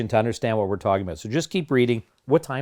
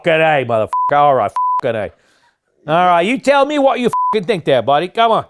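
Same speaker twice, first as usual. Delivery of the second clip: put-on loud, rowdy Birmingham-style accent; British accent; 5 semitones higher, 7 dB louder than usual. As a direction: bellow it in a rough British accent, climbing high. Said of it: "your British accent".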